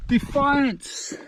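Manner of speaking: high, defiant shout; triumphant cry. Real, not acted. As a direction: proclaim it with defiance. Shout at the top.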